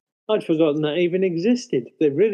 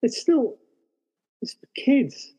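incredulous; 8 semitones higher, slower than usual. Real, not acted. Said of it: "And I thought".

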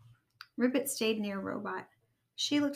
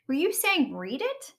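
raised, rising question; dawning question; 5 semitones higher, 3 dB louder than usual. A narrator's reading. Rabbit checking what Ribbit meant, surprised question. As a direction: ask it as realization dawns.

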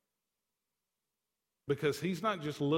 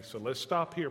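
ordinary talk, nothing marked

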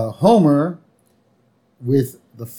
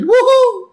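high, loud whooping "woohoo"; drawn-out high cheer; yelled woohoo; 6 dB louder than usual. Retold or imitated. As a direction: whoop it out high like Homer Simpson. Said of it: "he's yelling".

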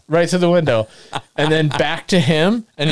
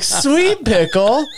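slow, higher, disbelieving repeat; bemused echo; 8 semitones higher, much slower than usual. Retold or imitated. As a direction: savor the absurd insult slowly. Friends echo the drunk's insult.

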